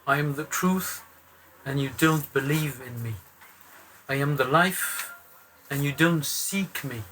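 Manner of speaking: ordinary talk, nothing marked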